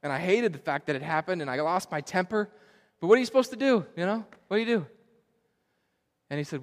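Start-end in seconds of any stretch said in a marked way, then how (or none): 3.0-4.8 exasperated retold question. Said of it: "I was telling him".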